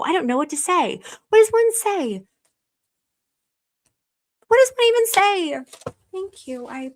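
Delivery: high, choked-up voice; lost for words, close to crying; overwhelmed, teary. Real, not acted. Streamer overwhelmed by viewer kindness, high and teary. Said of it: "I'm overwhelmed".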